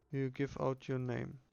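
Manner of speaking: normal talking voice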